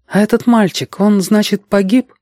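put-on high girlish question; concerned girl. A narrator's reading. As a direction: ask with concern in a high girl's voice. Girl asks if boy died. (обеспокоенная девочка, высокий голос)

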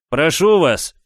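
ordinary delivery, nothing marked

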